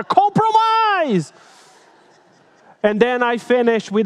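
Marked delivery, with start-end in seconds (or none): 0.0-1.3 a long, drawn-out, high cry of Compromise; much louder. Retold or imitated. Preacher calls out to a cold congregation, long and high. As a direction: stretch out Compromise high and slow, pleading. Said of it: "I spoke even louder".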